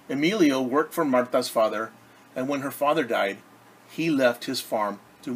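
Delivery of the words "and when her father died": ordinary delivery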